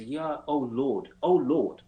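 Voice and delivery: put-on a light Southern accent, slowed right down; Southern drawl. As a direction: stretch the Lord out like a Southern Baptist soldier. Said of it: "he said this with a Southern drawl".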